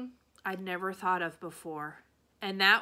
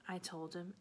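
plain reading voice

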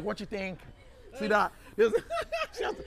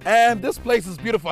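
loud, slow TV presenter intro; 8 dB louder than usual. Acted. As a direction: announce it like a show host.

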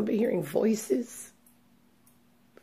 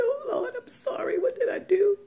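much higher, tearful plea to God, breaking; retold crying plea; 13 semitones higher than usual. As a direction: beg the Lord through tears, high and thin. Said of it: "and I'm crying".

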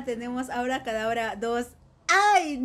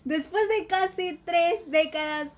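ordinary talk, nothing marked

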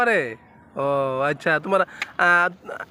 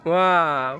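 a low, falling wow of admiration; admiring wow; 4 semitones lower, much slower than usual. Real, not acted. Parent praising toddler's walk with a low, admiring wow. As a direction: marvel at the little one's walk.

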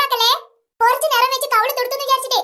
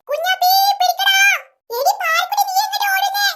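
put-on high child figure calling out; excited chase; 7 semitones higher than usual. Children chasing their playmates, excited high calls.

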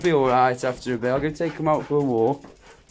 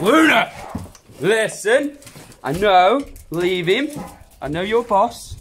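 high, loud squeaky dog voice scolding the pup; voicing the dog Luna; 7 semitones higher, 7 dB louder than usual. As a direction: speak for the bossy dog, yelling at the newcomer. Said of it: "She's telling him, she's like".

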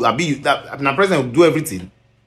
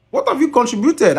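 the same question again, much higher and pushed; high exasperated repeat; 12 semitones higher than usual. Real, not acted. Scolding the singer, high exasperated demand. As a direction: demand it in a sharp high cry.

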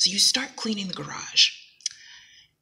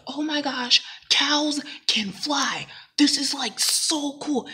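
higher and louder, thrilled to share big news; bursting with glee, higher pitched; gushing excitement; 7 semitones higher, 4 dB louder, much slower than usual. Retold or imitated. Telling a loved one about flying cows, gushing with excitement.

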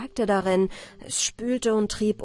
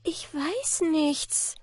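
helpless answer; 8 semitones higher, much slower than usual. A narrator's reading. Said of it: "Da antwortete Anna Barbara".

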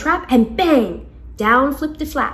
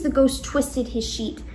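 ordinary delivery, nothing marked

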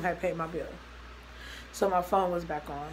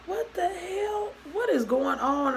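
high, drawn-out retold alarm; alarmed questioning; 10 semitones higher, slower than usual. Girlfriend hears screaming on the phone, acted alarmed questioning. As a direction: act out bewildered panic, pitched way up.

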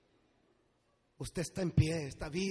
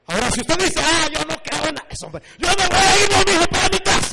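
shouted, high-pitched preacher's challenge; full-force preaching; 11 semitones higher, 15 dB louder than usual. Real, not acted. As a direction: shout out the objector's words from the pulpit. Preaching, mimics an objector.

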